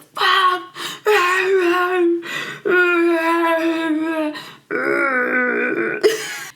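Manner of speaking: long, high, overdone crying howl; hammed-up wordless meltdown, wailing on and on; mock freak-out wail. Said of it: "he freaks the frick out".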